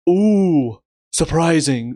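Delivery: a loud, hammed-up ooh dripping with sarcasm; sarcastic mock surprise. Real, not acted. Reviewer mocking the show's twist, loud sarcastic ooh. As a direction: pretend to be shocked when you clearly aren't. Sarcastic reaction to character death.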